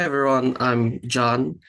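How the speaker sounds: ordinary talk, nothing marked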